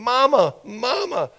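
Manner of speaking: put-on a lost blind boy calling for his mother; crying child. Blind boy crying out for his mother, high pleading call. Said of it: "he's crying with tears".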